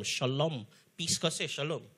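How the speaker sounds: soft, low, blessing the storm; gentle blessing. Shown as a demonstration. Jesus gently blessing the storm, soft and low. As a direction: speak peace over it softly. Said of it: "gently to the storm".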